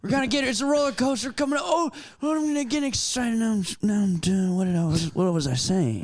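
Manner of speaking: high, rambling old politician impression; doddering mock-presidential voice, trailing into confusion; Joe Biden. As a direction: ramble excitedly, then lose the thread. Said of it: "It's pretty good Biden".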